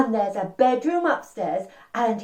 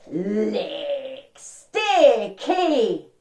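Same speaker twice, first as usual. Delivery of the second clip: long, savoured, singsong honey words; drawn-out honey licking; much slower than usual. Acted. Storyteller shows children Mister Bear licking honey, drawn-out and playful. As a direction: stretch each word out slowly, lifting on the second.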